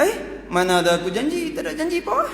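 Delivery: high, scornful denial; indignant denial. Acted. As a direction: brush her off with contempt. Menteri menafikan janji. (menteri menafikan janji dengan nada tinggi dan menghina)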